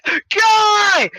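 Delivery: high, drawn-out shout; mock anguished cry. Friends watching the guy fall, mock anguished shout. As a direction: wail it as if a friend fell.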